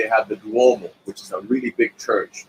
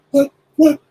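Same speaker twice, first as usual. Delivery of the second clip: imitating confused Tito; 6 dB louder than usual. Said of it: "And he goes".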